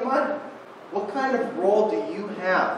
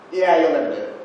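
mocking inner voice; 9 dB louder, faster than usual. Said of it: "a little voice in my head's like".

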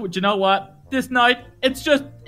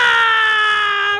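exasperated scream; 8 semitones higher than usual. Acted. Fooled dad erupts at his son, exasperated scream. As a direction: let out a long howl of frustration. Dad's outburst after being fooled.

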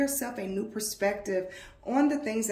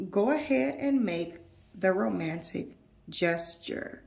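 normal talking voice

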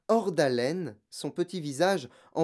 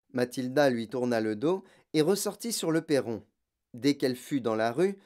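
usual speaking voice

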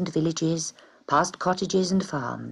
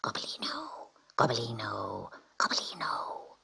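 breathy hissed whisper of a name; hissing whisper; 7 semitones lower, 11 dB quieter than usual. A narrator's reading. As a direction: hiss the name round the cages. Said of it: "a kind of hiss".

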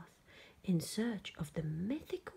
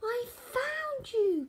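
high, loud, drawn-out delight; joyful cry; 5 dB louder, slower than usual. A narrator's reading. George meets the real Yeti, high joyful cry. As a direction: stretch it out in triumph. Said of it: "cried George".

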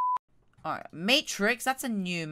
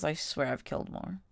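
ordinary delivery

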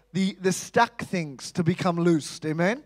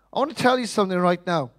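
ordinary talk

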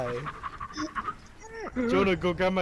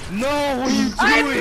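loud, high shout of disbelief; 8 semitones higher, 9 dB louder than usual. Real, not acted. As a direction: yell at your teammate in disbelief. Teammate misses the snipe.

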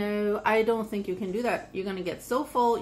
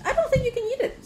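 teasing; 3 dB louder, faster than usual. Acted. Parent teasing child about sandwich.

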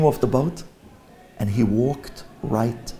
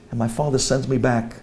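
ordinary talk

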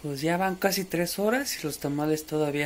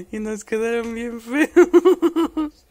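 laughing; 7 semitones higher, 11 dB louder, much slower than usual. Real, not acted. Laughs at ugly tamales.

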